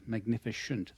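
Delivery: ordinary delivery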